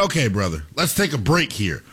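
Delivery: lightly voiced body, pitched well down; body talking. Player's body tells him to rest, lightly voiced low.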